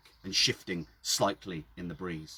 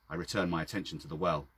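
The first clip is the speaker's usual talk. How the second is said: plain reading voice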